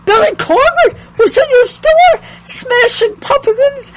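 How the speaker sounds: high, loud, incredulous outburst; astonished son. Retold or imitated. Son to mother on the phone about a rock star, astonished. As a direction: blurt it out in starstruck disbelief, voice shooting up.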